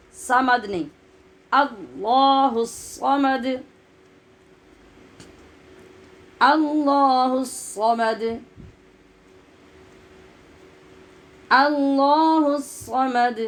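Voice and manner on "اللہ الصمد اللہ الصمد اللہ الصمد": slow, drawn-out tajweed chant; slow Quran recitation. Shown as a demonstration. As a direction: recite each word long and measured. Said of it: "recitation demo".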